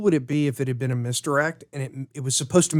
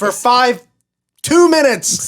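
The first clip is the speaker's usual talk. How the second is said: loud, high mock demand; Michael Ironside; 9 semitones higher, 6 dB louder, slower than usual. Bargaining for time with the room, hammy yell. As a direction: yell it hammily, climbing higher. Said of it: "Michael Ironside said".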